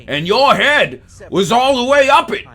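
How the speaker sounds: high, loud, hammed-up movie-star yell; Al Pacino. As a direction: shout it in an over-the-top star impression. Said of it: "that's my Al Pacino impression".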